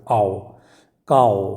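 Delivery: आओ, काओ low, with a full "ow" glide; low, drawn आओ, काओ; diphthong demo. Shown as a demonstration. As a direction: model "ow" in आओ and काओ. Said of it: "यहाँ पे sound आ रहा है".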